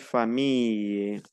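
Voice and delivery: famille with a long "ee" gliding into "ye"; elongated double L. Shown as a demonstration. Teacher models double L as I-E, stretched "famille". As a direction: draw out the end of famille. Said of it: "es un poco más alargada".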